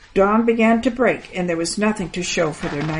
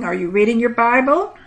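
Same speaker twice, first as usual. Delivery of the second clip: ordinary delivery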